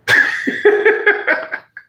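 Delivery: a disbelieving, airless high laugh; high breathless laugh. Real, not acted. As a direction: burst out laughing at the shock. Realizes the preacher is the imam.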